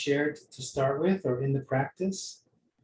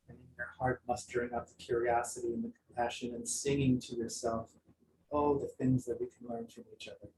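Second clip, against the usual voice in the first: guided meditation; 4 semitones lower, 4 dB quieter than usual. Acted. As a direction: gently guide them back to the room.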